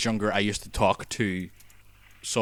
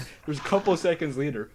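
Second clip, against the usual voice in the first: normal talking voice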